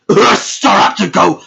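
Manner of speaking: loud, drawn-out rallying cry; laughing hype shout for Staraptor; team cheer. Acted. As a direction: cheer your team on like a battle call. Cheering for own division.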